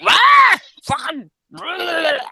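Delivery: hammed-up furious shrieking, very high; mock screeching rant, loud burst then drawn out; Annabelle. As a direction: imitate someone exploding into angry roars. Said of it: "she's really angry".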